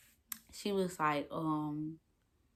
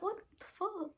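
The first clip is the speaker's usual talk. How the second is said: very high, soft stunned question; retold shock; 9 dB quieter than usual. Woman still looking at her partner, stunned. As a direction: squeak it out softly, stunned. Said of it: "I'm shocked".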